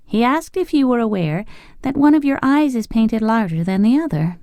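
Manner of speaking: mischievous. A narrator's reading. The girl mischievously teasing the Scarecrow, high and playful. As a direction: tease the king with a playful lilt. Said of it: "said the girl mischievously".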